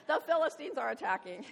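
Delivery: loud, high frantic cry into a phone; mock alarm. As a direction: blurt it out as breaking news. Saul's call on his cell phone.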